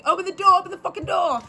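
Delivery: high, urgent mock New York yell; hammed-up New York accent, desperate to escape; New Yorker on the subway. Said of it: "I'm in the subway. I'm trying to escape".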